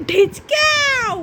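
long, slow, high dog command; drawn-out command. Shown as a demonstration. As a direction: stretch the word ঢিচকাও out slowly as a cue. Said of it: "একটু ঢিচকাও করে দেখো তো".

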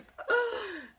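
big mock gasp of alarm. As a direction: gasp for the toddlers, hammed up. Panda falls into water.

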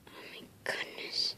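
hushed whispered wonder; whispered awe. Real, not acted. Kid amazed by the room, whispering. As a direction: breathe it in awe.

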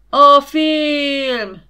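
high, loud crowd roar; crowd howling. A narrator's reading. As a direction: howl it as the crowd. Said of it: "üvöltötte a tömeg".

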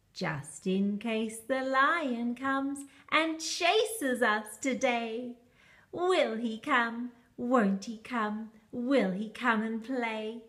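ordinary delivery

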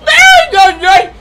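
very high, loud shrieked ay, ay, ay; shrieking ay. Acted. Grandma squealing over her grandson's big gift.

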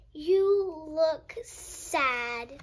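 drawn-out, slow and sympathetic; slow sad observation. Acted. Freddy telling sick Minnie she looks sad, slow and gentle. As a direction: let it sink in slowly.

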